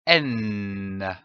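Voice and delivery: a long hummed "N" held through the nose, pitch gliding down; sustained N sound. Shown as a demonstration. Teacher showing pupils the sound N, long humming "N". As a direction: hold "N" as a steady nasal hum for the class. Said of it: "Je prononce le son".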